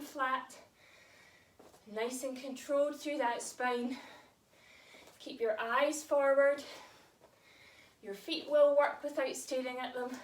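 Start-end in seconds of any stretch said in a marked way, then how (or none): 5.2-10.1 winded mid-workout, pushing words out; out of breath. Real, not acted. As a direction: talk while short of breath from exercise. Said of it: "I'm quite out of breath".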